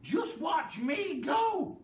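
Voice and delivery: very high, loud cackling crone voice; a mad old woman crowing in triumph; Grandma crowing as she grows, shrill glee; wild old grandma. A narrator's reading. Grandma character voice.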